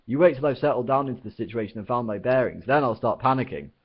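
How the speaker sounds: snapping. Acted. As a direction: bite back, offended. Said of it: "snapped Arthur".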